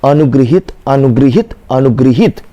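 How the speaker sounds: अनुगृहित repeated low, with 'gri'; correct pronunciation drill. Shown as a demonstration. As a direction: chant अनुगृहित evenly at a low pitch, rising at each end. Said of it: "क्या बोलिए?". (अनुगृहित दोहराएँ, नीचे सुर में 'गृ')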